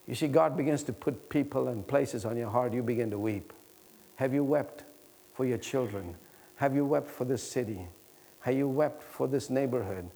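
low, soft, grave appeal with long pauses; hushed prayerful preaching. Real, not acted. Preacher praying for a heart broken for the lost, hushed prayer. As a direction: bring it right down into a heartfelt prayer.

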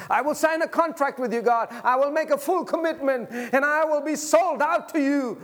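loud, high preacher's cry; full-force preaching. Acted. Pastor urging the congregation to be full of Jesus, preaching loud. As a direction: preach it out to the whole church.

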